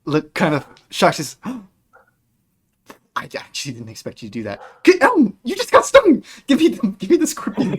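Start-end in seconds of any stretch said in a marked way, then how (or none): 3.1-7.8 high, loud panicked shriek as the friend gets stung; panicked squeal. Acted. Elena shrieking at Elm to hand over the scorpion, high and frantic.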